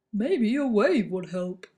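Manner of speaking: slow, helpful barber voice; lightly voiced barber suggesting; kangaroo barber. A narrator's reading.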